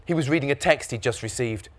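plain reading voice

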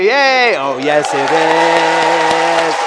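high, loud triumphant whoop; real whooping cheer. Real, not acted. Celebrating with the audience after their chant, loud cheer. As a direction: cheer loudly, holding the last word out.

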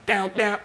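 high sung-out imitation of a riff; vocalised guitar riff. Shown as a demonstration. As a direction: mimic the guitar riff with your mouth. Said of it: "we were going".

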